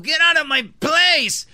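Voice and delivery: lightly acted indignant threat; mock fed-up woman. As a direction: imitate an irritated neighbour ordering someone off. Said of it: "la vieja cansada".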